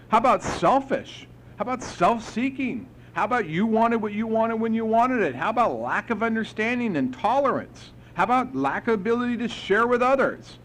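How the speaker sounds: retold sponsor pressing him; pointed lecture. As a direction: hammer each defect home. Sponsor listing defects.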